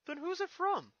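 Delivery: put-on high, loud young dragon voice; Spike's voice. Young dragon asks Twilight about the letter, high and eager.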